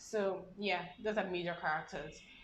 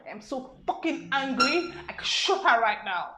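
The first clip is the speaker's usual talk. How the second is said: American accent fury; 6 semitones higher, 10 dB louder than usual. Shown as a demonstration. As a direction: growl it with a put-on American fury. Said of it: "something like that".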